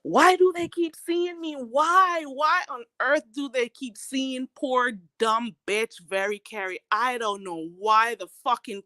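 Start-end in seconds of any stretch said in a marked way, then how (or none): none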